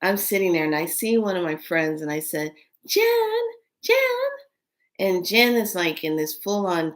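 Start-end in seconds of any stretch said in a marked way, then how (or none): 2.8-4.0 high, loud call across a room; calling out a name. Retold or imitated. Calling to her friend across the room, high and loud.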